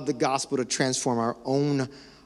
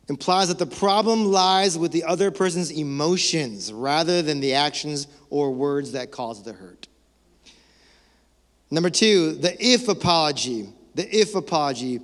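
ordinary talk

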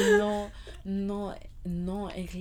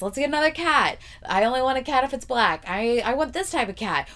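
put-on higher, louder childish demands; whiny kid voice; 7 semitones higher, 6 dB louder than usual. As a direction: whine it like a spoiled kid. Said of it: "he's like a kid".

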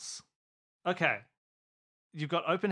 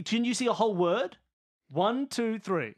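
ordinary delivery

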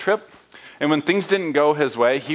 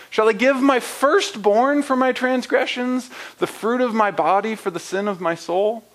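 exasperated people; 9 semitones higher than usual. Acted. The people answering God, exasperated and high. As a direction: plead helplessly, piling up offers. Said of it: "The tone of the people's response seems to be exasperated".

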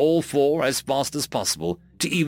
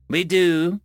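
firm; 6 semitones higher, 4 dB louder than usual. Acted. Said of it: "said Brill firmly".

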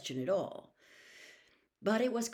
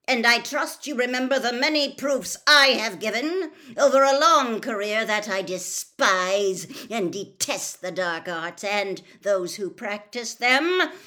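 loud, high, indignant tirade; angry shout; 6 semitones higher, 12 dB louder than usual. A narrator's reading. Mister Crouch defending his long career, shouting. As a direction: shout it with outraged pride. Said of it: "Mister Crouch shouted".

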